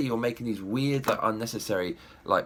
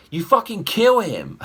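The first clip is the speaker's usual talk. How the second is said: much higher and louder, blurting out the punchline; outraged shout; 9 semitones higher, 8 dB louder than usual. Real, not acted. Ranting to viewers about how Wakandan kings are chosen, an outraged shout. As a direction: shout it like it's absurd.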